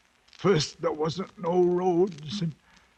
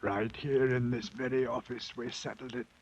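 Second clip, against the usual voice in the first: low, soft, worn out; a man at the end of his strength; weary, broken voice; exhausted; 3 semitones lower, 7 dB quieter, faster than usual. Acted.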